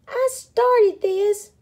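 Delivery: high, drawn-out moan. A narrator's reading. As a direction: moan it out slowly, high and loud. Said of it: "moaned Pipsqueak".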